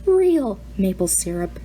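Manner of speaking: ordinary delivery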